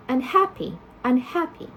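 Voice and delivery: unhappy stressed on HAP; stress unchanged by prefix. Shown as a demonstration. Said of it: "same place of stress".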